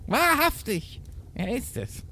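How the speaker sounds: very high put-on goat mother; goat mother's voice. A narrator's reading. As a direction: exclaim in a squeaky mother-goat character voice. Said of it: "die hätte dann anders reden". (hoch verstellte Geißenmutter-Stimme)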